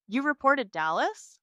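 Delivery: high, loud shocked question; shaking voice. A narrator's reading. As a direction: ask it in stunned disbelief. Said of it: "Dad's voice shakes".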